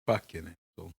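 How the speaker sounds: low, soft grumble; muttered groan. Real, not acted. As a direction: grumble it under breath. Groaning reluctance.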